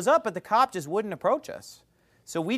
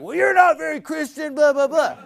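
high, loud imitated retort; mock shout; 9 semitones higher, 6 dB louder than usual. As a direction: shout it back like the old guy. Old guy shouting back.

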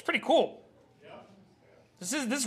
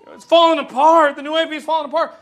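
raised, loud mock panic; mock alarm; 4 semitones higher, 10 dB louder than usual. Preacher imitating church members who fear collapse, in mock alarm. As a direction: exaggerate a panicky wail, louder and higher. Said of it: "some people are like".

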